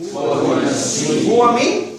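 a slow, drawn-out Huwa min with a rising end; teacher drawing the word min out slowly; stretched prompt. Shown as a demonstration. Teacher prompting the class with a slow, rising question.

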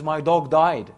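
loud mock Australian outburst; retold protest in a rough Australian accent. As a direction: exclaim it loudly in an imitated Australian accent. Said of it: "his own Australian accent".

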